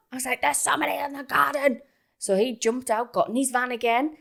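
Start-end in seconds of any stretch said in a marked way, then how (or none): 0.4-1.8 higher, stressed repeat of a warning; acted urgent insistence. Woman repeating intruder warning to partner, acted urgent insistence. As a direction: insist on it, mock-panicked.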